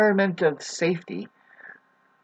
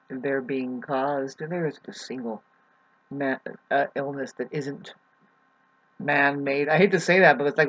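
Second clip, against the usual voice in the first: ordinary delivery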